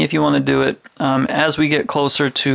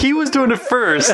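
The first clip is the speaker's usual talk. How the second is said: laughing tease; 10 semitones higher than usual. Real, not acted. Teasing Ricky while laughing.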